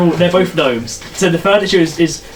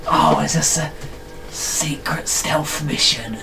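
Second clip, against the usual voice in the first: conspiratorial hush; 8 dB quieter, slower than usual. Acted. Gnome catches on to secrecy.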